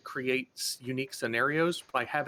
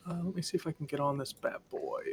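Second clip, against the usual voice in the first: whispering to himself; 4 semitones higher, 4 dB quieter than usual. Real, not acted. Muttering while setting up mic.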